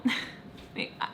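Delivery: soft, breath-only laugh. Real, not acted. Teller finds it so stupid, amused laugh. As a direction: quietly laugh to yourself. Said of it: "I'm laughing 'cause".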